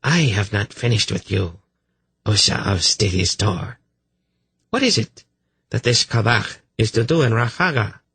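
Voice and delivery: lightly voiced imperious priestess; interrogating priestess. A narrator's reading. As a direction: question a suspect with mild authority.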